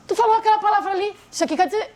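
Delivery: high, rapid nitpicking; retold arguing. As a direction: re-enact pouncing on a spouse's wording. Na minha discussão com meu marido. (discussão encenada, aguda e acelerada)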